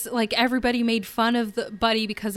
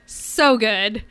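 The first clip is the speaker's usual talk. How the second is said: usual speaking voice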